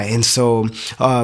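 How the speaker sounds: ordinary delivery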